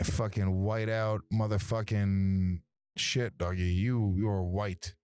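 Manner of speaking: very slow, drawn-out rant; slow drawl. Acted. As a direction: stretch every word out mockingly.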